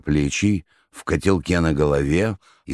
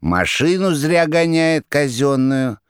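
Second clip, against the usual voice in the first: slow, high sneaky cat voice; tattling cat; 9 semitones higher, slower than usual. Acted. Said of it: "наябедничал и кот".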